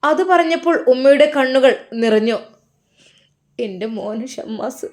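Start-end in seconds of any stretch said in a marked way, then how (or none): 3.6-4.9 soft, tearful sigh of a name; much softer, on the edge of tears. A narrator's reading. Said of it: "എന്താ കരയുന്നത്?". (കണ്ണ് നിറഞ്ഞ്, വളരെ പതുക്കെ)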